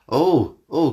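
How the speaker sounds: ordinary delivery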